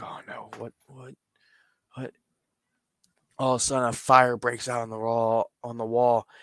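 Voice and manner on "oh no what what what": scared whisper. Retold or imitated. Lights go red, Darby frightened.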